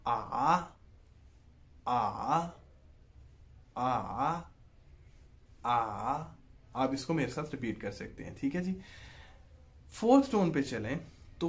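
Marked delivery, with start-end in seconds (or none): 0.1-6.2 "आ" falls then climbs back up, Mandarin third tone; third tone demo. Shown as a demonstration. Teacher showing students the third tone on a vowel, dipping then rising. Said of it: "voice नीचे आई और फिर ऊपर चलेगी".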